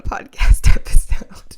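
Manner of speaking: teary whispered laugh through emotion; laughing it off while holding back tears; tearful laugh. Real, not acted. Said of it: "I'm gonna get emotional".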